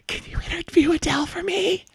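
high, soft tearful squeak; crying. Acted. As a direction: squeeze out the request through tears. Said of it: "he ran into the bathroom crying".

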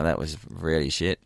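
low, quiet deadpan verdict; flat, dry. Retold or imitated. Employee's unspoken reaction to boss's bad gag, flat and dry. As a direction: say it softly in a flat, dry undertone. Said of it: "a flat, dry manner".

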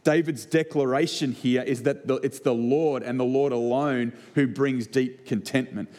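ordinary delivery

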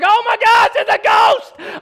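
mock-panicked high, loud shriek; freaking out. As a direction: cry out in exaggerated terror at a ghost. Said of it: "Like, they freak out".

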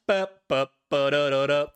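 beatbox the eighties synth line; synth beat imitation. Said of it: "synth breakdown where it's just".

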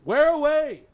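high, loud shouted reply; bellowing over the wind. Acted. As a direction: shout back up to the mast. Answering the lookout's call.

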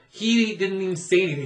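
normal talking voice

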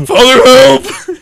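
very high, loud, stretched yell; mock cry for help. Echoing the co-host's joke, drawn-out mock scream. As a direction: wail it out slowly, hammed up.

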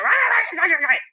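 frenzied mock dog noises; mock poodle frenzy. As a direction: imitate a little dog going berserk. Imitates the poodle going wild.

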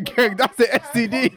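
high, loud, slow through laughter; laughing. Real, not acted. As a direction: burst out laughing. Cracking up at joke.

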